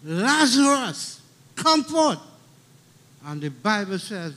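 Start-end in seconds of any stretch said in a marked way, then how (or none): 0.0-2.2 high, ringing call drawn out slowly on the name; loud call. A narrator's reading. Jesus summoning Lazarus from the tomb, a high ringing call. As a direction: call the dead man out of the tomb. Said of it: "he called with a loud voice".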